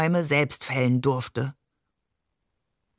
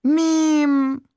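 high, louder mournful cry of the name; a boy grieving his cat; wailing Mim, much higher; lamenting; 9 semitones higher, 5 dB louder than usual. A narrator's reading. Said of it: "klagte er".

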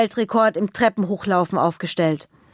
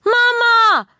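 high, loud shout for mum; child yelling; 6 dB louder than usual. Acted. Little girl yelling for her mum in the rain. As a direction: bellow for Mama like a soaked little girl. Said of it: "brüllt Juna".

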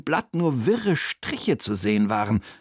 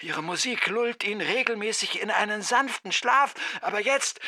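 very high, soft voice on the line; distant phone caller; 9 semitones higher, 4 dB quieter than usual. A narrator's reading. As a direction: explain urgently from the other end of the phone. (hohe, leise, ferne Telefonstimme)

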